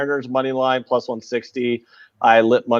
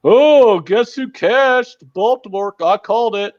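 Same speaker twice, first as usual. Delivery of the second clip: high, loud, slow gloating mock voice; mocking bettor; 11 semitones higher, 5 dB louder, slower than usual. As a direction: ham up a smug bragging bettor. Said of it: "begging everybody".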